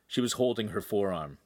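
ordinary delivery, nothing marked

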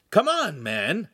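high, loud start dropping to normal; urging. A narrator's reading. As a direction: urge a friend to leave. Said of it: "Handsome urged".

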